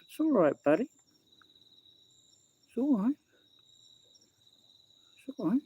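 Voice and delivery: high, soft reassuring voice to the cat; "it's all right, buddy" crooned to a pet; soothing pet talk. Real, not acted.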